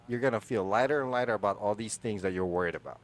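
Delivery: ordinary delivery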